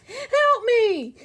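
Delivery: panicked dragon shriek; screaming Dragon. Acted. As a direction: scream for help. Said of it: "he screamed".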